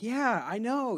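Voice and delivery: very high, loud mock agreement; fake positive. Imitating in-your-face fake-nice people, gushing falsely. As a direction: gush with put-on positivity. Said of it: "people have that fake positive vibe".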